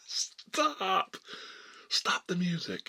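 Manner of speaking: high-pitched imitated teacher shout; acted command to stop. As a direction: call a halt over the band. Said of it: "puts his hands up and goes".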